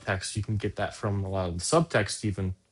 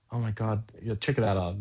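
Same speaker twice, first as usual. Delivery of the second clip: ordinary delivery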